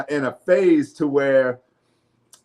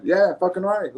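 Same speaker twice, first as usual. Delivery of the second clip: normal talking voice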